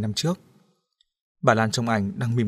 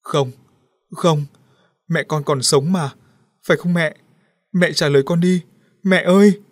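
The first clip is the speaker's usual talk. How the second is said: rising sobbing plea, higher and louder; a daughter crying over her mother, building to a wail; begging her dead mother to answer, louder and higher; 6 semitones higher, 7 dB louder than usual. A narrator's reading. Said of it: "Liễu òa khóc".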